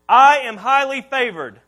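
loud declaration, measured for repeating; first call to repeat. Shown as a demonstration. Preacher gives the congregation a line to repeat, loud declaration. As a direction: declare it loudly. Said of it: "I want you to repeat this with me".

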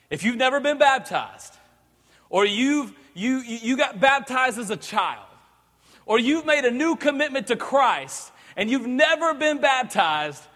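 ordinary talk

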